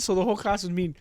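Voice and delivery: ordinary delivery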